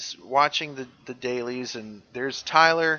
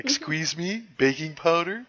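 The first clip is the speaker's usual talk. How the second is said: high, rising double question; incredulous movie-quote imitation; Wayne's World quote; 5 semitones higher than usual. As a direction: toss it out as a mock-baffled catchphrase. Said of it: "That was a Wayne's World thing".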